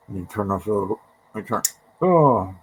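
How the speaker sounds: talking around a paintbrush held in mouth; muffled words, brush clenched in teeth. Real, not acted. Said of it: "try not to talk with the paintbrush in my mouth".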